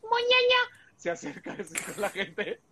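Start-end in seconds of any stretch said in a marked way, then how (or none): 0.0-0.8 grito de susto muy agudo; suéltalo agudísimo para asustar; imitando al del TikTok. Retold or imitated. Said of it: "Hace sustos buenísimos". (imitación aguda del susto de TikTok)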